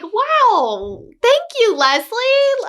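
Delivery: imitating herself thanking an excited fan; delighted thanks. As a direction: thank her brightly and eagerly. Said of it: "And I'm like".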